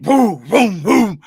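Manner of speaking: loud, high mock engine booms. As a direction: imitate a V8 rumbling. Imitating a V8 engine.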